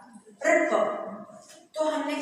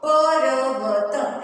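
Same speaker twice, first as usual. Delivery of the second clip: पर्वत drawn out slowly and sung, louder; slow sing-song reading; 6 dB louder, much slower than usual. Shown as a demonstration. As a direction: chant पर्वत slowly for the children. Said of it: "वैसे से हमने क्या किया?". (पर्वत को धीरे-धीरे गाकर खींचना)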